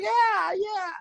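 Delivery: high, sweet agreeable yeahs; polite work voice. Retold or imitated. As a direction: play along in a bright customer service tone. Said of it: "And I'm like".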